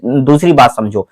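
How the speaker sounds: normal talking voice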